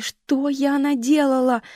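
выше, громче и медленнее; закричи в отчаянии; отчаянный крик. A narrator's reading. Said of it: "отчаянно кричала Энди". (дочь в отчаянии медленно, высоко и громче кричит)

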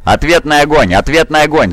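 high urgent repeated command; shouted battle order. A narrator's reading. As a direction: cry out to rally the troops. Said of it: "закричал генерал Дев". (боевой приказ высоким криком)

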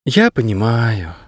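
put-on slow, resigned girl's voice; a touch of weary resignation; heavy-hearted. A narrator's reading. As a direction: concede slowly, as if sighing. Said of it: "девчонка тяжело вздохнула".

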